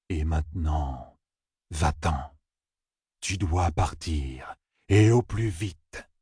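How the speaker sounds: low, soft, slow prophecy; solemn oracle man. A narrator's reading. Oracle man foretelling Matt's fate, slow solemn voice. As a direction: intone the fate slowly and low.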